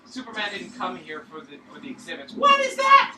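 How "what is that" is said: sudden high startled cry; surprised. Real, not acted. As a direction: yelp it in shock. Surprise at freed creature.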